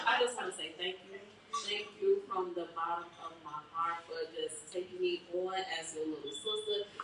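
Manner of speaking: soft, heartfelt thank you; sincere thanks. Real, not acted. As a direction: thank her softly from the heart.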